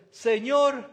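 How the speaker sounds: loud desperate call; crying out. A narrator's reading. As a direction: cry out to the Lord. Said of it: "dio voces diciendo". ("Señor" clamado en voz alta)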